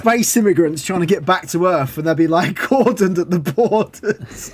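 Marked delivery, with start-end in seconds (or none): 2.6-4.5 slow words through laughter; amused, laugh-broken delivery. Real, not acted. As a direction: crack up while finishing the joke. Cracks up at own joke.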